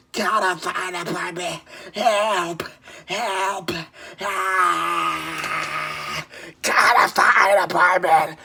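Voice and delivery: strained crying for help; desperate cries. Real, not acted. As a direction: cry out in real desperation.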